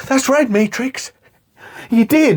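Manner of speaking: high, slow pleading imitation; Sully impression. Sully dangling over the cliff, high put-on voice. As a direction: mimic the dangling villain's high voice. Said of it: "Sully's like".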